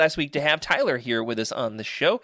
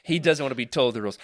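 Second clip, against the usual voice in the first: normal talking voice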